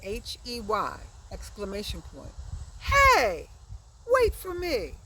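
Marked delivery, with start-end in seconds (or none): 2.8-4.9 high, loud call of hey, wait for me. Shown as a demonstration. Teacher calls out the example to the class, loudly. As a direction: call after friends running ahead. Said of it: "the interjection is hey".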